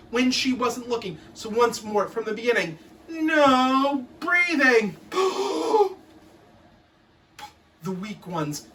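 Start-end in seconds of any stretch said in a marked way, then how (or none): 3.1-5.0 slow drawn-out command, a bit louder; strict teacher bark. Retold or imitated. Teacher orders the class, slow bark. 5.0-5.9 high gasp for air. Retold or imitated. Pupil out of air, high gasp.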